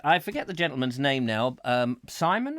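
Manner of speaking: ordinary talk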